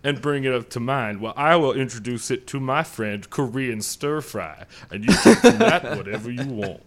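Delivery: a genteel Southern gentleman voice, hammed up; Southern gentleman accent. Podcast host opens the show in a mock Southern accent. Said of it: "something to be said for that accent".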